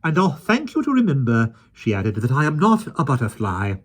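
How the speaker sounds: high, loud haughty rebuke; Mary Poppins rebuking the keeper; prim rebuke. A narrator's reading. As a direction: put him in his place.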